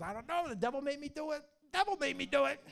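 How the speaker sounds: comic excuse. Retold or imitated. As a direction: play up the silly excuse, punching it out. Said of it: "he always used the same excuse".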